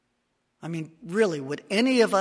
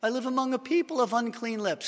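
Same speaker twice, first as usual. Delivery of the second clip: despairing Isaiah; 8 semitones higher than usual. Acted.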